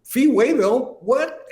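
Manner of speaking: high, loud disbelieving yelp; imitating a stunned friend; surprised. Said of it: "And Jim goes".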